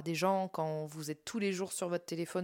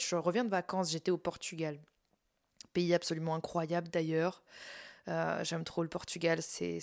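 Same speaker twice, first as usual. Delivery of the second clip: usual speaking voice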